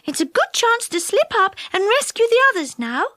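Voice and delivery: high, light fairy voice; fairy girl, hopeful. A narrator's reading. Silky scouting for the Sandman, high and hopeful. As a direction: speak up high, keen to get going.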